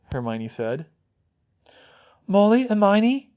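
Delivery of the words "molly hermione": high, slow, frightened appeal; breathless, terrified. A narrator's reading. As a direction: call to them for help in fear. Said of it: "Fleur said breathlessly".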